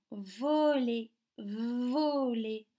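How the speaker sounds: "voler" with a long, strong vo; stressed first syllable. Shown as a demonstration. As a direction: stretch the v and o at the start of "voler". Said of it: "Quelle syllabe".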